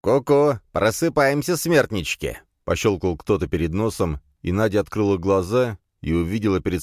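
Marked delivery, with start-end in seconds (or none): 0.0-2.4 higher, teasing taunt; mocking wake-up call. A narrator's reading. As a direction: rouse the prisoners with a jeer. Villain taunts bound captives awake. (насмешливая побудка, голос выше)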